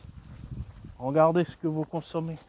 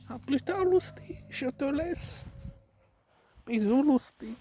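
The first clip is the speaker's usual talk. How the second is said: high pet-talk voice; 11 semitones higher than usual. Real, not acted. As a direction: coo up high at the animal. Saying goodbye to the animal.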